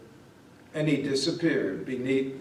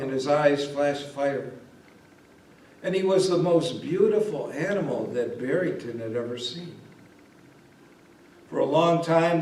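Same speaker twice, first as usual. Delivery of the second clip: plain reading voice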